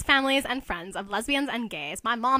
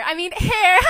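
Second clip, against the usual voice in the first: campy quip; 7 semitones higher, 8 dB louder than usual. Acted. Nelson camping it up.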